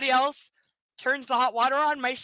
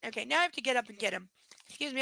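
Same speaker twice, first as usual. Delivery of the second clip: normal talking voice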